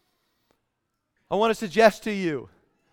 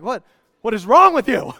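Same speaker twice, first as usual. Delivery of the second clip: baffled buddy; 2 semitones higher, 9 dB louder than usual. Retold or imitated. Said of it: "my buddy is in his truck like".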